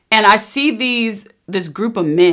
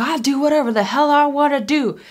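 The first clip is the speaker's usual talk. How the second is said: higher, defiant mock snarl of the woman; retelling the attacker's defiant retort in a higher voice; 7 semitones higher than usual. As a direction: throw the line back at her, higher and spiteful. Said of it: "And she was like".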